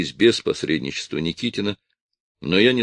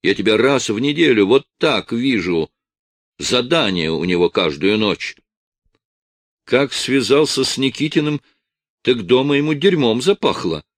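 wife yelling; 5 semitones higher, 4 dB louder than usual. A narrator's reading.

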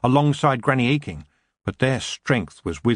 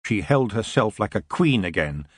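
ordinary delivery, nothing marked

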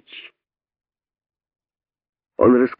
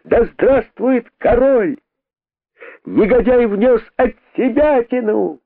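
high slow chanting cry; crowd cheer; 13 semitones higher, slower than usual. A narrator's reading. Rabbits cheering the king, high chant. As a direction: chant like a relieved mob.

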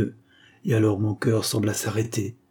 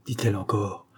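ordinary delivery

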